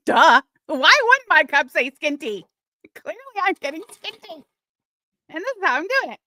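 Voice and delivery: Corky's boast, hammed-up and high; mocking Corky. Mocking Corky bragging about her cup.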